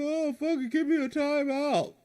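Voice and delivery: high, whiny mock of a spoiled kid; bratty kid voice. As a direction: mimic a lazy teen brushing you off. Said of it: "they say".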